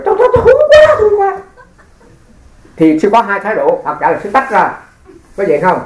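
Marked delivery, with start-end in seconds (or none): 0.0-1.4 very high, loud squeal of protest; mock disgusted outcry. Monk recoiling mid-chant from his neighbour's smell, comic high outcry. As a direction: blurt it out high and hammed-up, with laughter around. Acted inner recoil at body odour.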